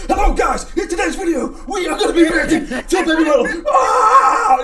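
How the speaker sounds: high, screaming hype greeting; coked-up YouTuber intro. Retold or imitated. YouTuber mocking his first reaction videos, manic shouting. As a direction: parody a manic video opening, ending in a scream. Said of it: "like, sniffing coke or something like that".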